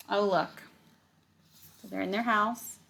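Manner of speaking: ordinary delivery, nothing marked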